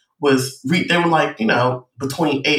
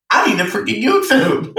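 higher, excited burst of sudden inspiration; excited realisation; 8 semitones higher than usual. Retold or imitated. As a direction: blurt it like a lightbulb moment. Acting out her sudden realisation.

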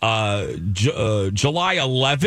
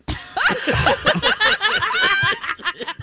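embarrassed laugh. Real, not acted. Embarrassed laugh after slip.

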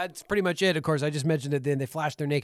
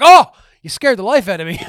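loud startled cry, higher; startled yelp; 4 semitones higher, 15 dB louder than usual. Retold or imitated. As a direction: jump and shout it out. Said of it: "Sid James is like".

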